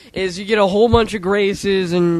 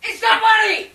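yelling; 14 semitones higher than usual. Real, not acted. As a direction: shout it high, on the edge of laughter. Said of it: "and then you yelled at".

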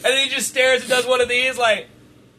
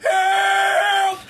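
mock shout, much higher; pretend cry for help; 11 semitones higher than usual. Pictures himself lost in the dark aisle, mock shouting for help. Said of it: "just go".

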